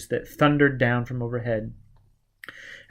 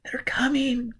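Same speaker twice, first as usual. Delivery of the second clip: urgent whisper, higher; 12 semitones higher, 4 dB quieter than usual. A narrator's reading. Giz whispering a warning to Gully. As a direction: breathe it out in alarm. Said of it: "Giz said".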